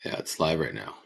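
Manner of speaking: normal talking voice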